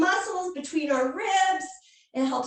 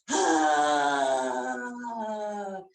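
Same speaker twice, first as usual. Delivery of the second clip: lion breath roar; 10 semitones lower than usual. Shown as a demonstration. Yoga teacher leading kids in a tongue-out roaring breath. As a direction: push the breath out hard, tongue out. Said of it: "stick that tongue out".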